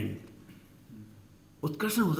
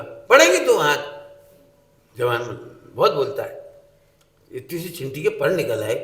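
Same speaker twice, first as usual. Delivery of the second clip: hammed-up furious mother; angry threat; 6 dB louder than usual. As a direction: threaten the cheeky child loudly. Angry mother scolding child.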